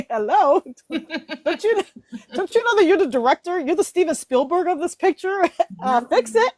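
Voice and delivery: high, giggly self-scolding; giggling. Real, not acted. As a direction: tease yourself through bubbling laughter. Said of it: "as I'm giggling right now".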